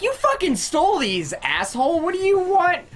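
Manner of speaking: indignant, high-pitched retort; acted-out incredulous scolding; exasperated outrage. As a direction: tell off a brazen customer, pitch jumping up. Acted angry retort to a thief.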